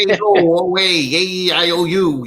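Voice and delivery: high, hammed-up New York accent; doofus New York friends. Doofus friends hollering at him back home, mock New York accent. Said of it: "these doofus friends of mine".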